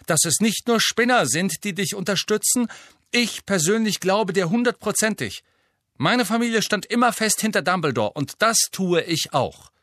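high, loud and solemn, slowing at the end; pompous loud declaration. A narrator's reading. Ernie pledging support to Harry, pompous and loud. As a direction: announce it grandly for all to hear. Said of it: "mit lauter, tragender Stimme".

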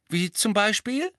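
bright high question; eager teenage girl asking; curious girl. Acted. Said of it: "fragte Ginny".

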